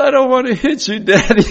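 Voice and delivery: put-on high, teary little-girl voice with a laugh; imitating his small daughter near tears; little girl's plea. Father retelling his toddler daughter refusing to hit him, high and teary.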